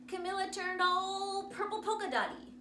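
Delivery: ordinary delivery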